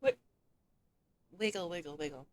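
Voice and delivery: talking through a mouthful of salad; words round a bite of food; mouth full. Real, not acted. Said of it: "Sorry, my mouth is full because I'm eating a salad".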